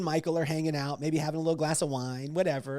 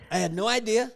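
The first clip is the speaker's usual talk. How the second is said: usual speaking voice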